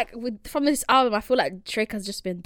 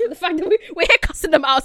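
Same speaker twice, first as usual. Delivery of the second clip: ordinary talk, nothing marked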